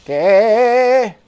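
high, loud, long-drawn question; incredulous cry. Real, not acted. Player interrupted by the game mid-chat, incredulous cry. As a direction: break off into a stretched, baffled cry.

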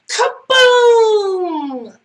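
high, loud, drawn-out boom; big storybook explosion shout; exploding kaboom. A narrator's reading. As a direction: shout it like a blast going off. Explosion sound word in read-aloud story.

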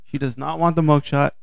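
usual speaking voice

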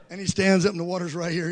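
usual speaking voice

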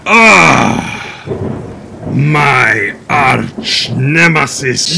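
slow hammed-up alarm; mock dramatic gasp. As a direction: gasp at your arch nemesis. King recognizes arch enemy.

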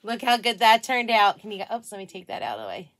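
ordinary talk

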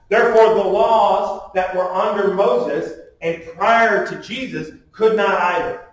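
normal talking voice

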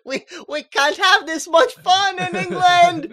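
mock British glee. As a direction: play a giddy English airman, hammed up. Pilot gleefully voiced in recap.

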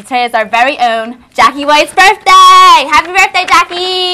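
loud cheerful birthday announcement; excited birthday shout. Real, not acted.

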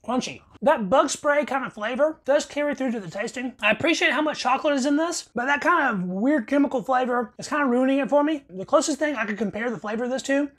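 chewing while talking; speaking between bites; mouth full of cookie. Real, not acted. Seen 3: Bites cookie at -2; mouth working, cookie in hand throughout.

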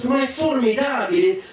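esclamazione molto acuta; grido entusiasta. Acted. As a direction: esulta con voce che schizza in alto. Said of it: "gridò Joseph". (grido acuto di entusiasmo)

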